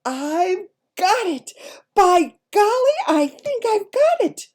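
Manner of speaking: excited cry. A narrator's reading. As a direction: shout the eureka moment. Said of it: "in a voice filled with excitement".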